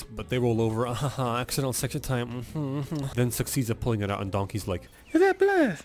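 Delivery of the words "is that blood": very high, loud squeal; mock panic. Donkey seeing the arrow pulled out, squealing panic. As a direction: squeal it in cartoon alarm.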